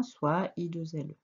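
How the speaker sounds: ordinary delivery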